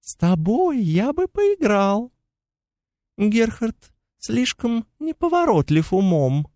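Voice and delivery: high, thin mocking fairy voice; chittering pixie. A narrator's reading. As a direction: tease in a tiny insect voice. Pixie creature voice held.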